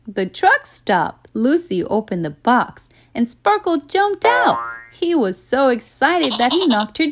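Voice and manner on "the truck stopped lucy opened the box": ordinary delivery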